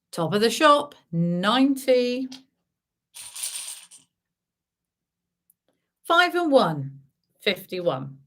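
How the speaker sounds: bingo caller calling numbers. Acted. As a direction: call each number out to the room.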